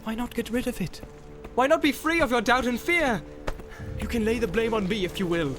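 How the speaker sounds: tempt, then threaten; Boromir tempting then crying out. A narrator's reading. Boromir tempting Frodo, rising to a threatening cry. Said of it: "he cried".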